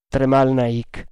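ordinary delivery, nothing marked

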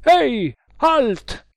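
high, loud sentry's challenge; shouted challenge. A narrator's reading. Hidden soldier stopping two fugitives, shouting. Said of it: "una voce gridò".

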